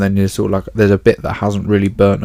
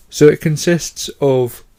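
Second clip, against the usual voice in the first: higher, slow words pulled out by a real yawn; 6 semitones higher, 4 dB louder, slower than usual. Real, not acted. Podcast host explaining soil, yawning mid-sentence. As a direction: talk right through a yawn you can't stop. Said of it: "sorry, I yawned".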